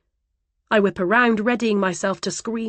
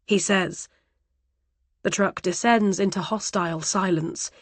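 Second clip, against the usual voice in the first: ordinary delivery, nothing marked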